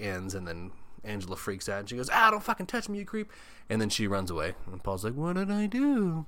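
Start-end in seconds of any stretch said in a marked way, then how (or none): 2.1-3.3 high mock outburst at a creep; freaked-out outburst. Angela freaks out at Paul. 5.1-6.2 high, slow bewildered whine; confused whine. Retold or imitated. Paul confused after rejection.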